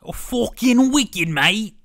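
slow, high, loud British exclamation; British accent. Pretend. Friend riffing on Harry Potter, loud mock-British accent. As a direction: belt it out in an exaggerated British accent. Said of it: "talk about Harry Potter".

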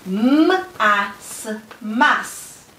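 M-A-S sound by sound, then "mas" as one syllable; sounding out and blending. Shown as a demonstration. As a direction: stretch the m, pause between sounds in "m-a-s", then say "mas". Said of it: "blend the syllables together".

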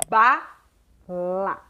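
"Bala" split as BA... LA with a long gap; syllable by syllable. Shown as a demonstration. Teacher blending sounds into two syllables, syllable by syllable. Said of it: "Esses quatro sons, na hora de falar".

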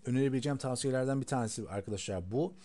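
ordinary talk, nothing marked

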